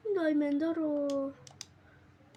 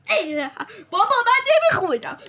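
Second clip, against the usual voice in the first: laughing protest; 6 semitones higher, 10 dB louder than usual. Real, not acted. Laughing protest while playing.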